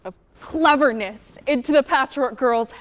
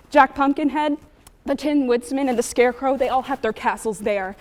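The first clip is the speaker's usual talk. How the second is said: ordinary talk, nothing marked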